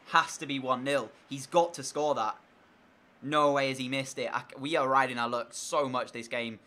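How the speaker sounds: ordinary delivery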